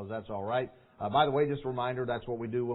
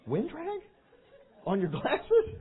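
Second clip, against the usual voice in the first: incredulous; 11 semitones higher, slower than usual. Real, not acted. Mocking his own boyhood logic.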